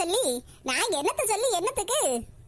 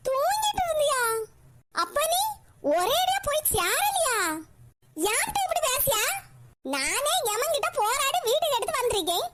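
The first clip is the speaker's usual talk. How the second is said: put-on higher, whiny old mother's complaint; sleepy old mother; 5 semitones higher than usual. Old mother scolding her daughter for waking her, whiny and higher. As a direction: grumble like a woken old woman.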